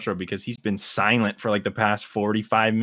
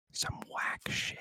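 whispered aside; whispering; 16 dB quieter, slower than usual. Real, not acted. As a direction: whisper it conspiratorially.

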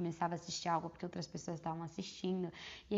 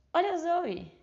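higher, louder burst of surprise; sudden exclamation; 9 semitones higher, 7 dB louder, slower than usual. Real, not acted. Podcaster spots her dog mid-recording, sudden delighted-surprise exclamation. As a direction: blurt it out, pitch jumping up.

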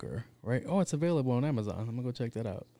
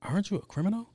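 much higher puzzled question; baffled question; 9 semitones higher, faster than usual. Pretend. Executive confused by [name]'s robot plan, higher and baffled. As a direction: ask it like nothing makes sense.

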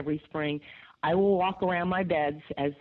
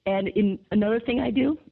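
rough, gravelly throat; 4 semitones higher, 4 dB louder than usual. Real, not acted. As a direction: talk through a gravelly voice. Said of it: "excuse me, it's my gravelly voice".

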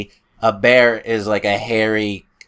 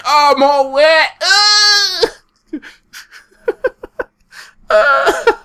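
put-on high, whiny old man complaining; 6 dB louder, slower than usual. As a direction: whine it out like a soaked old man. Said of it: "That's my impression".

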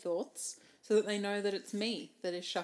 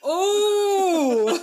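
loud high laughing ooh; shocked delighted ooh; surprised ooh; 8 semitones higher, 13 dB louder than usual. Real, not acted. Reacting to death card.

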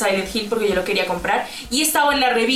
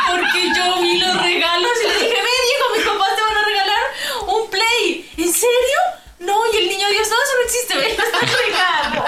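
put-on excited child; 10 semitones higher than usual. Girl telling her brother about the Christmas presents, excitedly. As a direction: blurt the secret like an excited little girl.

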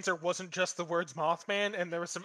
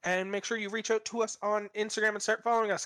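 ordinary talk

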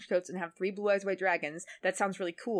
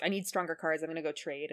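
ordinary talk, nothing marked

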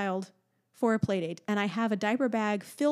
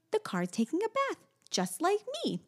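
put-on little girl voice; 10 semitones higher, 3 dB quieter than usual. Toddler delighted.

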